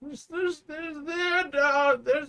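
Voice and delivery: put-on high, slow old man shout. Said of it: "shouting in the old man voice".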